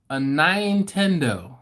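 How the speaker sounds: "Nintendo" with a long-I first syllable, NINE-tendo. Retold or imitated. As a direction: stretch it slowly as nine-TEN-doe. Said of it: "I can't say it the way he said it".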